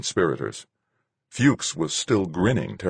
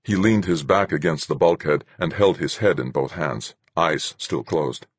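plain reading voice

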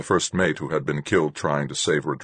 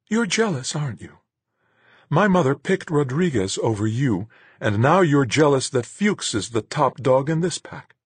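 taunting woman; 10 semitones higher than usual. A narrator's reading. Marguerite taunting him, voiced differently.

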